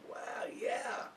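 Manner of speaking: hushed, whispered amazement; awed whisper. Real, not acted. Beer reviewer savoring a sip, awed whisper.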